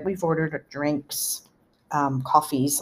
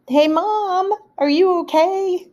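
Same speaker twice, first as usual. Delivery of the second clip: higher and louder, calling through the stall door; calling to mom; 13 semitones higher, 4 dB louder, slower than usual. Retold or imitated. Daughter calling through a door to her stuck mother, worried. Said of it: "And then I said".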